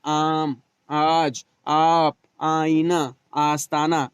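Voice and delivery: each word opening on a drawn-out aa, "आम, आज, आप"; teacher's list with long initial aa in "आम"; stretched long alif. Shown as a demonstration. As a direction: hold the first aa of "आईना, आस्ताना". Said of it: "अलिफ ममदूदा को खींच कर पढ़ा जाता है".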